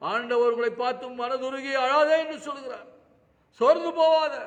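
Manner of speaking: high, loud preacher's cry; full-force preaching. Acted. Preacher urging grieving listeners not to lose heart, loud preaching cry. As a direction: call out to comfort the listeners.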